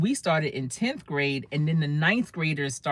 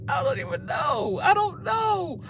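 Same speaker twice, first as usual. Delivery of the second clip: breathy, broken near-sob; a touch of a crying teen; retold teary bewilderment; 12 semitones higher than usual. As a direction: answer through mock tears, lost. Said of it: "So I started crying".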